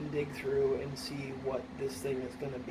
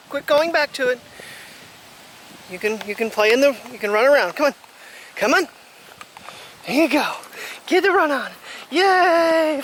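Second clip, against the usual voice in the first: much louder and higher cheering the dog on; excited urging; 8 semitones higher, 10 dB louder than usual. Real, not acted. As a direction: cheer the pup into running.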